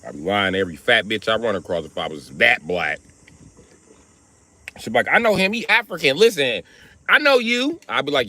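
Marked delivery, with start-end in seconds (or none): 5.1-7.8 insistent woman. Acted. Woman insisting she knows him.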